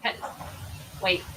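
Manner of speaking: normal talking voice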